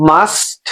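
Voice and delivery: MUST with the S first, then a distinct T; T sound after. Shown as a demonstration. Teacher showing the order of sounds, MUST with late T. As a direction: separate the T at the end of MUST. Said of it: "পরের টি সাউন্ডটা".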